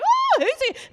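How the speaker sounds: high, loud puppy talk; loud, gushing dog-lover voice. Retold or imitated. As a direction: coo loudly to drown out the swearing. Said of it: "My lo-, voice is a lot louder".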